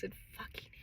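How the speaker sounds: breathy whispered aside; whispered resignation. Real, not acted. Resigned aside after rant.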